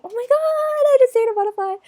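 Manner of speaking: high, excited squeal of wonder; gushing delight. Pretend. Mom voicing her delight at a butterfly, high excited squeal. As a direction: gasp it out like seeing magic.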